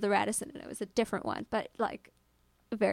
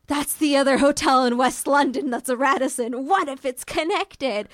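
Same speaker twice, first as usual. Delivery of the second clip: dramatic suspicion; 2 semitones higher, 5 dB louder than usual. Retold or imitated. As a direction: build the creepy theory. Building a spooky theory.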